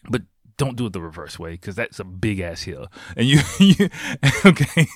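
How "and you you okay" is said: laughing excitedly. Real, not acted. Laughing while warning about the hill.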